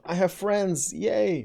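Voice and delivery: high, mock-cheery "I have friends, yay"; sarcastic mock-excited impression; mocking imitation. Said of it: "her expression of".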